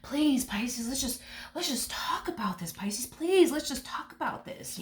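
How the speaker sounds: a hint of a hushed desperate plea; mock pleading. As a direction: wheedle like a remorseful ex. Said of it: "talk to you, fix things with you".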